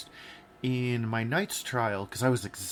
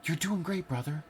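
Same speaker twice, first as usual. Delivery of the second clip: higher, softer coaxing voice of a kind friend; gentle encouraging friend; 6 semitones higher, 4 dB quieter than usual. Retold or imitated. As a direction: soothe him mid-fight, high and hushed. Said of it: "the whole time he was like".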